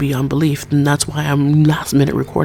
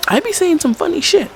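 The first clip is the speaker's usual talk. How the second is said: high-pitched; 10 semitones higher than usual. Real, not acted. Sudden high playful brag.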